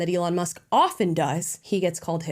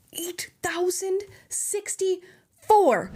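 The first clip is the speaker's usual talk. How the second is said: whispered, very slow number reveal; hushed, drawn-out reveal of the pardon count; dramatic whisper; 10 semitones higher, much slower than usual. Acted. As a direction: breathe the figure out slowly as a shocking secret.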